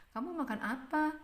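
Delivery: high-pitched question lifting on "apa"; rising question. Shown as a demonstration. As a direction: ask it high, sending "apa" upward. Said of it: "nadanya Mem Ita di belakang meninggi".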